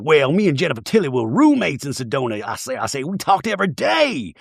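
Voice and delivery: mock Southern lady, slightly lilting; Sutton. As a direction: play it prim with a Southern accent. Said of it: "And Sutton's like".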